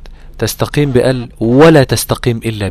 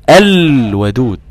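slow drawn-out الودود with a clear lam; recited demo; 6 dB louder, much slower than usual. Shown as a demonstration. Teacher repeats the example word slowly for the student. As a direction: recite الودود slowly, each letter distinct. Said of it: "والحرف باينين من بعض".

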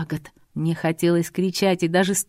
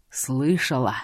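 low, hoarse thug voice; 6 semitones lower, slower than usual. A narrator's reading. Bearded thug mocks the girl, low and hoarse. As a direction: rasp the question out low. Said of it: "хрипло спросил тот".

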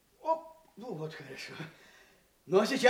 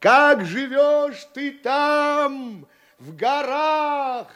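very slow, high, loud hailing; calling into mountains; 7 semitones higher, 10 dB louder, much slower than usual. A narrator's reading. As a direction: stretch each word across the valley. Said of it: "Крикнешь ему". (протяжный громкий зов в горы)

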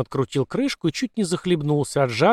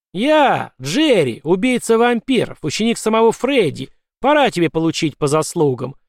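heroic proclamation; 6 semitones higher, 4 dB louder than usual. A narrator's reading. Jerry proclaims himself vampire slayer.

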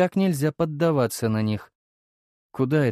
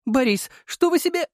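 возмущённая девушка; 3 dB louder than usual. Acted.

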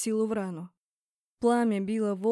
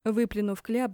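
usual speaking voice

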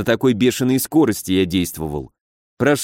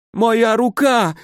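high, slow wail of pain; pained moan; 11 semitones higher, 3 dB louder, much slower than usual. A narrator's reading. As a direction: moan in horror at a broken arm. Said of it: "простонал Ганс". (протяжный высокий стон от боли)

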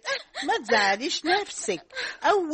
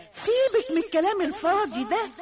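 ordinary talk, nothing marked

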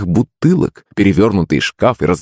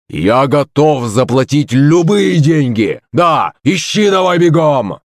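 put-on drunken shouting; 7 semitones higher, slower than usual. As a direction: bellow like a furious drunk. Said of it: "кричал он пьяным голосом".

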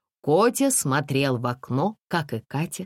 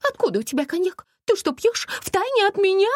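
much higher, piercing shriek of a panicky lady; shrill lady's cry; 3 dB louder than usual. A narrator's reading. Panicky lady cries out in the dark bus. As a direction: screech it like a hysterical lady. Lady scolds son about cognac.

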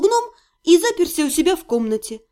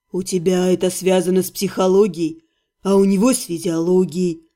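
put-on calm old healer; 7 semitones lower than usual. A narrator's reading. Healer calming the heroine, low and patient. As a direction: soothe her gently.

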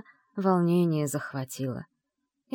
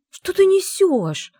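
high, loud, drawn-out outrage; indignant; 13 semitones higher, 8 dB louder, slower than usual. A narrator's reading. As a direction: cry out in indignation, stretching the words. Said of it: "возмутилась я". (громко и протяжно возмущаясь)

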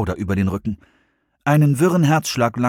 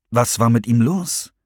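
plain reading voice

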